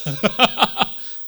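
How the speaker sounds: loud villainous cackle; evil laugh. Acted. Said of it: "Vocês gostaram da minha risada maligna?".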